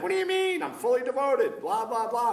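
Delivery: loud, high-pitched mock outrage; indignant inner protest. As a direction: protest your devotion, then wave it off in blahs. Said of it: "That's what's going on in my head".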